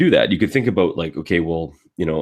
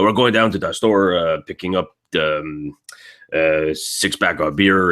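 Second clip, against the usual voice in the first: guttural Quebec French accent; rough Quebec accent. Shown as a demonstration. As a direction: talk like a rough Quebecois guy. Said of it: "it's very rough. It's very guttural".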